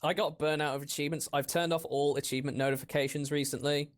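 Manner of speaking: ordinary delivery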